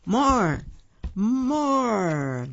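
high, drawn-out crowd cry; crowd shouting. A narrator's reading. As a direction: call out like an eager crowd, second cry longer. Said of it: "others' voices shouting".

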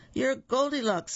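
high-pitched exclamation of recognition; astonished realization. A narrator's reading. Three little pigs recognize Goldilocks, astonished. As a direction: narrator voicing pigs exclaiming together.

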